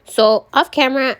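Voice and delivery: ordinary delivery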